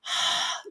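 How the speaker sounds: a soft unvoiced haa from a squeezed throat; throaty fogging exhale. Shown as a demonstration. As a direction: breathe out as if misting a window. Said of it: "constrict the muscles in your throat".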